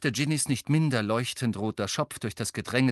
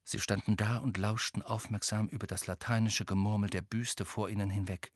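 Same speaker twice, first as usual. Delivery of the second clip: usual speaking voice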